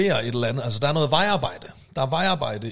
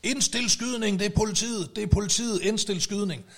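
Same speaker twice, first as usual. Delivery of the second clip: retold shout; 6 semitones higher than usual. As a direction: call it out urgently like an officer under fire, voice held back. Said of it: "han råber så".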